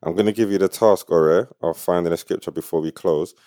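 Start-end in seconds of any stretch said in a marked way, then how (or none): none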